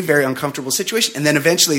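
normal talking voice

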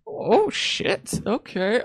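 normal talking voice

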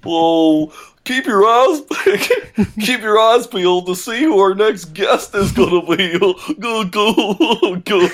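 high, laughing cartoonish sign-off; silly high voice. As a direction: squeal it out playfully, laughing.

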